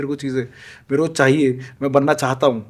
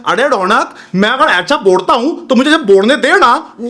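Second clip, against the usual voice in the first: raised, louder mock argument voice; replaying a heated comeback, higher and louder; retold heated retort; 7 semitones higher, 6 dB louder than usual. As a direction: snap back at a friend, half-acted. Arguing with Raunak in retold fight.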